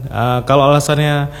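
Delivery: ordinary talk, nothing marked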